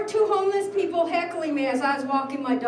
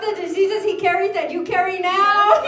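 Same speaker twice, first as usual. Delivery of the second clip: louder, building tirade, half laughing; retold tirade; 2 semitones higher, 5 dB louder than usual. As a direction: rip into her, enjoying every word.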